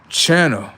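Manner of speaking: teacherly emphasis on channel; spelled-out explanation. Shown as a demonstration. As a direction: lean on channel as the key word. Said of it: "C H stand for channel".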